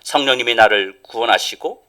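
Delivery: loud, high, driving sermon delivery; rising pulpit fervour; forceful preaching. Acted. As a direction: preach it out to the whole church.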